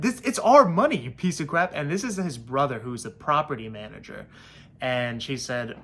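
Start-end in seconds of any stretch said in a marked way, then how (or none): 0.0-1.7 indignant outburst. Real, not acted. Venting at landlord.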